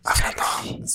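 barely voiced whisper; a quick whisper to show talking softly. Shown as a demonstration. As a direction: breathe the word out without voice. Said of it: "se acaba hablando bajito".

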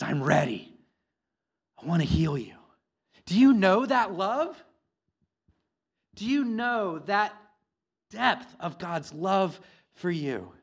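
high, loud, fervent sermon delivery; preaching at full force. Acted. Pastor urging the congregation to know God's love, preaching loudly. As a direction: preach it out to the whole room, urgent and pleading.

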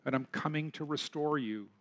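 a lightly voiced, gentle Jesus; reassuring reply. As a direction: answer like a caring shepherd. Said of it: "Jesus will respond by saying".

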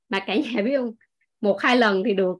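normal talking voice

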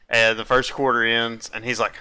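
normal talking voice

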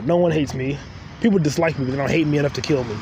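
ordinary talk, nothing marked

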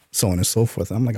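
ordinary talk

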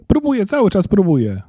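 put-on high, exasperated child; frustrated girl, pitch right up; exasperated protest. A narrator's reading. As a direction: protest in a high, pleading wail. Girl protests she keeps trying.

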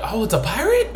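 a lightly surprised "a pirate?"; mock surprise. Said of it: "I was like".

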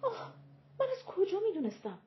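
mock-angry whine. A narrator's reading. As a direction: stamp your foot with the words, pitched high. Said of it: "از عصبانیت پاهایش را محکم". (اعتراض عصبانی با صدای خیلی زیر)

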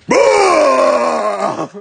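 sudden loud "Bah!" to startle; startle shout. Retold or imitated. Husband startling his wife from behind, loud shout. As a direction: bark it out to make her jump.

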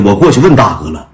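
usual speaking voice

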